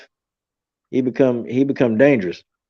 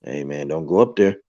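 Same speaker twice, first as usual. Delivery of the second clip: little voice; 6 semitones lower than usual. Retold or imitated. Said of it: "heard a little voice".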